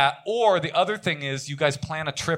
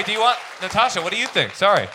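ordinary talk, nothing marked

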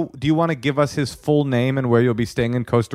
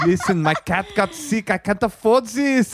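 hammed-up French accent, pitched higher, laughing; 7 semitones higher than usual. Host playing the French date asking for money, mock French accent. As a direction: whine in a mock French accent about the cat. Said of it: "I did the French accent".

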